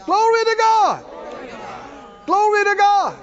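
high, loud, ringing praise; shouting praise. Real, not acted. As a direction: shout it to the rafters. Preacher praising at full force.

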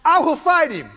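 high, forceful, confident vow; strong resolve. Retold or imitated. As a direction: declare it firmly, faith at full strength. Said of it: "It just gets stronger and stronger".

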